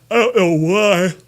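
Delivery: words stretched through a real yawn; mid-yawn. Real, not acted. Streamer yawning mid-stream, talking through a yawn. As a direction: yawn your way through it slowly. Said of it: "why I'm yawning my ass off".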